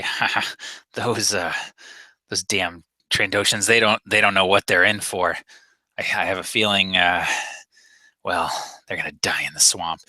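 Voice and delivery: low muttering to himself, half whispered; muttered under his breath. Acted. Rhodian grumbling under his breath about Trandoshans. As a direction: mutter it to yourself, not meant to be heard. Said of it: "he's saying it sort of under his breath".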